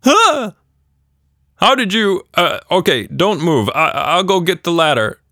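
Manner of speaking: high, loud startled yelp then flustered; startled dad. A narrator's reading. Father seeing daughter high in a tree, startled and flustered. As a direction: stammer in shock.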